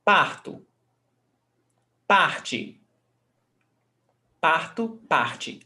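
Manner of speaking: Parto and Parte, each stressed on the first syllable; stress before last. Shown as a demonstration. Teacher demonstrating the stressed syllable to students, penultimate stress. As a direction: hit "PAR" in Parto and Parte, endings light. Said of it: "before last".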